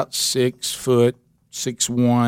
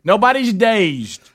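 mock women insisting; 9 semitones higher, 3 dB louder, slower than usual. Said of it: "now the women say".

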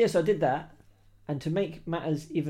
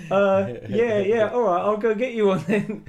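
laughing; 7 semitones higher, 10 dB louder than usual. Retold or imitated. Imitates cowed salesman, laughing.

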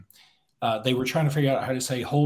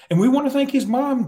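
much higher pitched; high announcer voice; 13 semitones higher, 7 dB louder than usual. Retold or imitated. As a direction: lift the voice high.

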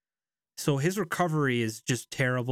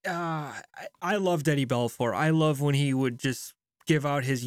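ordinary talk, nothing marked

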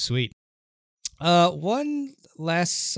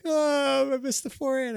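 high mock whine; imitating whiny voice; 12 semitones higher than usual. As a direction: copy his whiny player voice. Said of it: "It was just like when you were like".

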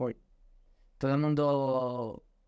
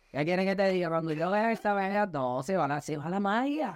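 tipsy slurred voice, pitched higher; drunk voice; 6 semitones higher than usual. Pretend. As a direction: put on a bit of a drunk voice. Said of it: "¿Esa es tu voz de borracho?".

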